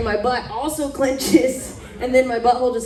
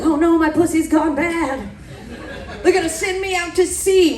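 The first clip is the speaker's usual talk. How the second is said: mock panic; 6 semitones higher than usual. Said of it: "I'm like".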